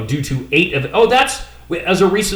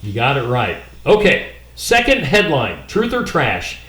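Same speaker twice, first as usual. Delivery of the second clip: usual speaking voice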